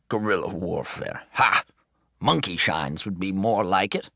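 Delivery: put-on laughing at the boy; teasing, half-laughing repeat; amused grandpa. A narrator's reading.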